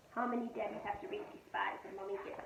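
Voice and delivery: usual speaking voice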